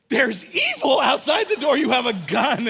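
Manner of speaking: high, loud mock panic; hammed-up frightened disbelief; panicked protest. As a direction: shout it like an alarmed kid. Retells panicked thought at door.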